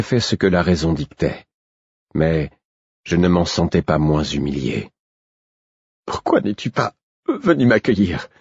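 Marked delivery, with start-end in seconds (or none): 6.1-8.3 high, breathless, tearful question; out of breath and upset; panting. A narrator's reading. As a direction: gasp out the reproach between breaths.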